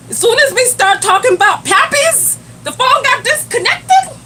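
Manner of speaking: high, loud, disbelieving question; surprised. Real, not acted. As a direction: cannot believe the call dropped. Call drops when fathers come up.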